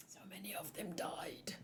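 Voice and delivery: a sorrowful whisper. Acted. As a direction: breathe it out, grieving. Reading a grim line of dialogue.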